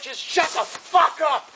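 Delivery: high, slow, drawn-out retort; retold angry outburst. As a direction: act out finally snapping back at him. Retold standing up to his uncle.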